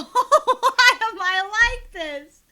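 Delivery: wheezing out the words through helpless laughter; barely getting it out mid-laugh; choked laughter breaking every word. Real, not acted. Streamer cracking up at dialogue.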